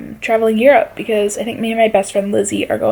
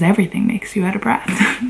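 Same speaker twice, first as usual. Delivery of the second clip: ordinary delivery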